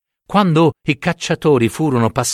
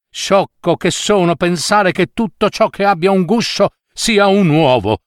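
moaning, groaning dog full of self-reproach; greedy dog in pain. Acted. Greedy dog moaning over his aching stomach, pained lament. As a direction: whimper and scold yourself, stomach aching.

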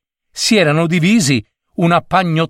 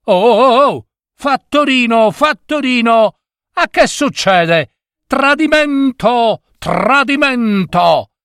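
alarmed cry; 10 semitones higher than usual. A narrator's reading. Passenger yells to the conductor that the bus went astray, alarmed cry. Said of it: "si mise a gridare".

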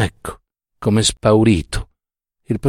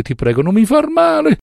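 high-pitched begging; frightened pleading; 3 dB louder than usual. A narrator's reading. Drunk professor begging his old pupil, frightened pleading. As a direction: shoot up high in a whimpering plea.

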